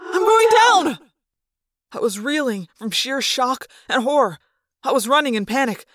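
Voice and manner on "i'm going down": high, loud battle cry; determined yell. A narrator's reading. Tobias diving at the monster, loud battle cry. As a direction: commit to the dive.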